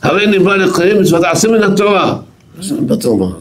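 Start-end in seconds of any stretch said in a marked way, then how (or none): 0.0-2.2 high-pitched proclamation, imitating the rabbi; retold shout. Rabbi cutting off his student to declare a commandment, retold shout. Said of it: "הקדים אותי וצועק".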